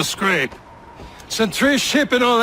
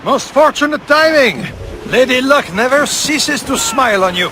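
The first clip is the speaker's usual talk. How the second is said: loud, raised, delighted; excited call; 5 semitones higher, 7 dB louder than usual. Acted. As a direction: cry it out over the battle noise. Ally excited at spotting target.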